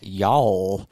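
imitated Southern drawl on y'all; Southern accent. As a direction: draw it out like a Southerner. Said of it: "no, in a Southern accent".

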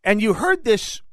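usual speaking voice

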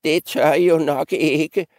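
put-on high-pitched old goat, needling. Old goat daring the three goats, high teasing voice. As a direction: taunt them as the old goat. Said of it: "fortsatte den gamle gedebuk".

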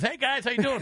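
high, put-on chummy greeting; imitated greeting. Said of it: "I know he goes".

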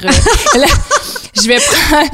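bursting into high laughter mid-sentence; laughing through it. Real, not acted. Guest laughing at how naive she was about setting her own hours.

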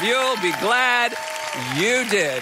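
big stage welcome; rousing introduction. Real, not acted. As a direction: call out the title to bring her on.

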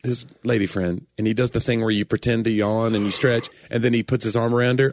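normal talking voice

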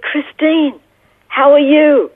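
high-pitched, happily surprised hello; delighted greeting. Retold or imitated. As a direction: act out a mild glad recognition. Retold surprise greeting from young man.

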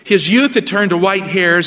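normal talking voice

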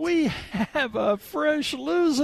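high, slow, gleeful host voice; game show host voice. Acted. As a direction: ham it up like a game show announcer. Host hams up welcoming a caller.

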